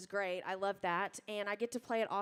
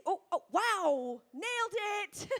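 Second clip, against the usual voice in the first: bright, surprised burst, higher and louder; surprised delight; 8 semitones higher, 3 dB louder than usual. Real, not acted. Singer to audience that guessed her state, delighted surprise. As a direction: react like the guess landed dead on.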